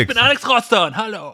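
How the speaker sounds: high, bright-eyed hero introducing himself; squeaky anime hero voice; game character voice. Acted.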